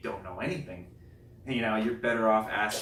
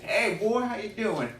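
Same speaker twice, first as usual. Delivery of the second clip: put-on Southern accent; 11 semitones higher, 3 dB louder than usual. Alabama neighbor greeting him, high Southern accent. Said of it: "Real Southern accent".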